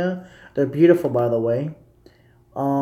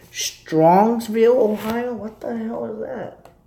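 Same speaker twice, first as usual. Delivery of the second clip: high-pitched, puzzled disbelief; baffled surprise; 7 semitones higher, slower than usual. Real, not acted. Streamer reacting to a viewer's unknown town, baffled. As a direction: draw out the name, then question it high.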